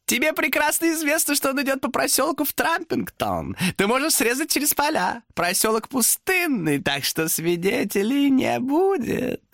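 high sly demon voice; demon objecting. Acted. Demon contradicts Thomas. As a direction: contradict him slyly.

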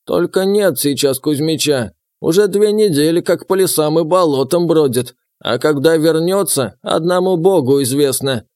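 put-on old villager voice; very old man.

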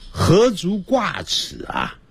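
ordinary delivery

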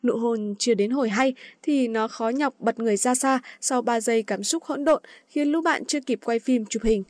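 plain reading voice

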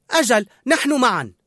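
high, loud insistence; emphatic assurance. A narrator's reading. Cook reassuring his partner, emphatic assurance. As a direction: insist firmly that it is so. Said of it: "فرد لينغويني مؤكدا".

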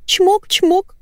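very high cooing kisses; burbling coo. A narrator's reading. Wife sending kisses to her dog, cooing. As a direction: send spoken kisses in high baby talk. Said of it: "журчала Валентина".

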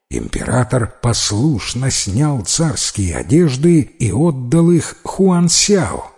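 plain reading voice

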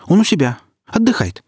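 high-pitched, over-eager reply; nervous underling's hurried excuse; too-hasty answer. A narrator's reading. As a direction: blurt it out high, covering up. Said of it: "Поспешно, слишком поспешно".

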